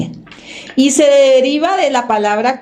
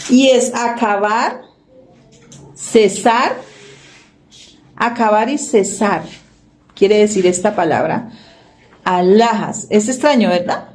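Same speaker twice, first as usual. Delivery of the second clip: normal talking voice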